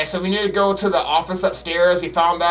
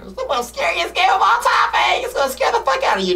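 mock hype voice; 3 dB louder than usual. Mocking the game's hype.

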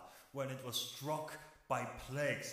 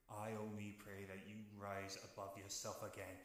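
quiet regret; 6 semitones lower, 11 dB quieter, faster than usual. Acted. Brother's quiet final verdict.

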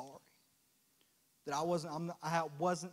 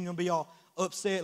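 ordinary talk

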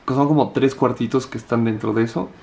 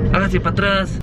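mock guard order; 6 semitones higher than usual. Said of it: "nadie nos tiene que estar diciendo".

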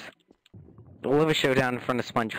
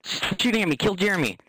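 urgent shout; 7 semitones higher than usual. Real, not acted. Under fire, calling out.

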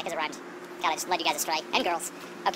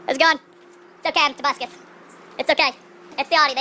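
loud, raised announcing voice; calling out; 6 semitones higher, 6 dB louder than usual. Pretend. Vlogger reassuring any neighbors it's Tobuscus, called out loudly. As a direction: project it out to the neighbors.

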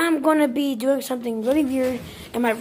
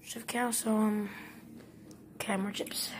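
whispering; 4 semitones lower, 14 dB quieter than usual. Real, not acted. Sneaky request for chips.

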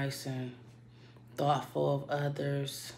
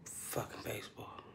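breathy whispered repeat, even softer; fed-up whisper; 3 semitones lower, 12 dB quieter than usual. Real, not acted. To herself in the bathroom stall, a fed-up whisper. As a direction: mutter it under your breath in disgust. Muttered repeat while hiding.

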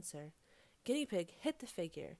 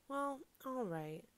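slow, higher, hesitant giving-in; reluctant agreement; 6 semitones higher, slower than usual. A narrator's reading. Guinea Pig hesitates, then gives up his place, reluctant. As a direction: give in slowly, drawing it out.

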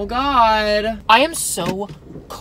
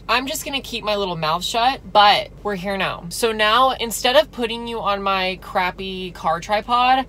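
usual speaking voice

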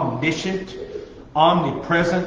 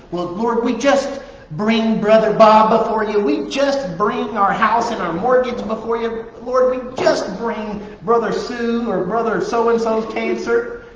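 higher, sing-song churchy prayer; mock "just" prayer; 8 semitones higher, 4 dB louder than usual. Preacher parodying churchgoers' prayers, higher and sing-song. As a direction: ham up a pious prayer full of "just". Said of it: "Stop talking like that".

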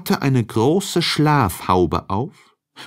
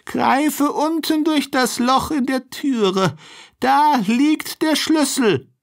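put-on much higher old woman's voice calling out; grandmother calling. A narrator's reading. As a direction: call the directions from her bed. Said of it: "rief die Alte".

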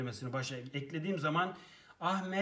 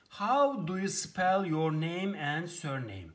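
slow careful read; 4 dB louder, slower than usual. Shown as a demonstration. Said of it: "slow teaching read".

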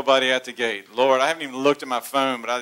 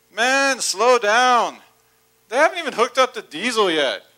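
calling out; 7 semitones higher, 5 dB louder than usual. Retold or imitated. Conductor calling to runner.

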